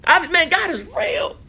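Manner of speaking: high, slow, marvelling God is real; amazed. Real, not acted. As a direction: let the wonder lift the voice high. Marvels at hair regrowth.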